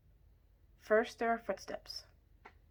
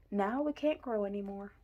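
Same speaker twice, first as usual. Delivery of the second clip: usual speaking voice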